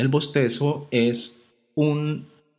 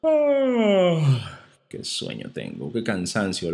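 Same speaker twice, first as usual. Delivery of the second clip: yawning; much slower than usual. Shown as a demonstration. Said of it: "Cuando bostezamos".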